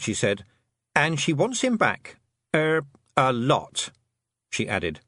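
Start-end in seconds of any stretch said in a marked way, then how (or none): 0.9-3.9 slow, hesitant admission; reluctant, halting confession. A narrator's reading. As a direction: drag it out unwillingly. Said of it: "hating to say it".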